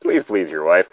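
normal talking voice

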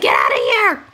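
playful dog voice staking its turf; a pet's pretend bark of a speech; dog's mock guard voice. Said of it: "He's like".

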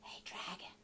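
barely audible whisper; hushed, tentative whispered call; whispered very softly. Acted. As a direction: whisper gently to a sleeper. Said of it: "Elizabeth whispered very softly".

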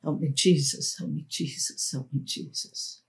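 soft prayer fading into a whisper; silent urgent prayer. Retold or imitated. A terrified girl praying silently, fading to a whisper. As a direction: pray under your breath until it's a whisper. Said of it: "pray silently and urgently".

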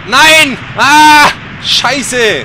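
very loud high scream of dismay; shocked yell at the crash. Real, not acted. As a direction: cry out as it fails. Crashing at level end.

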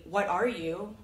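ordinary talk

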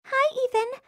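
high, sweet cartoon girl; sweet girly voice. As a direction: chirp it like a shy cartoon girl. Cartoon girl answers her crush.

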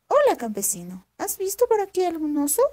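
voz de zorra aguda y viva, más alta; zorra astuta. Acted. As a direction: una zorra lista que pregunta con curiosidad. (la zorra pregunta al campesino asustado, voz aguda y curiosa)

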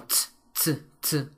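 bare voiceless "ts, ts, ts" sounds. Shown as a demonstration. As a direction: isolate "ts" with no vowel, repeated. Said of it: "se pronuncia como".

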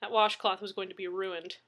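ordinary delivery

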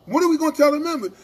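high, loud, quick question; pushed-up, urgent retelling of a demand; exasperated demand. Retold or imitated. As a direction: fire the question back insistently. Repeats the acted demand.

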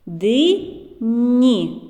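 "Ди, ні" split into two clear beats; syllable by syllable. Shown as a demonstration. Teacher counting syllables for children, slowly split. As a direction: clap out each syllable apart. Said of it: "скільки складів у цьому слові".

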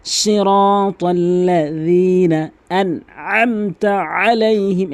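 slow melodic tilawat; Quran recitation. Acted. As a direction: chant it with long held vowels.

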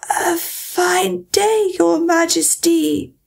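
very slow, high, faltering Duchess; timid, weak greeting to a queen. A narrator's reading. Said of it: "in a low, weak voice".

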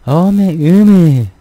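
slow, loud, high Jeolla exclamation; a loud, drawn-out Jeolla country exclamation; Jeolla accent. Shown as a demonstration. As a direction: drag out 어메 in a thick Jeolla accent. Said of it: "되게 좀 강하대요".

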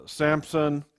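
ordinary talk

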